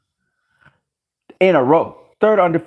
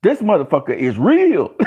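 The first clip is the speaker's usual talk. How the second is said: normal talking voice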